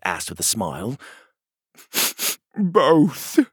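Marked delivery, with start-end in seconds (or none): 2.6-3.4 鼻をすすりながら; sniffly. Acted. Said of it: "Petey sniffled".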